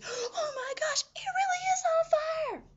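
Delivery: very high, drawn-out mock shriek; freaking out. Nurse seeing the flames at the door, freaking out. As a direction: gasp it, then build to a loud squealed panic. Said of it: "You're freaking out".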